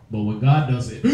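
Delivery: normal talking voice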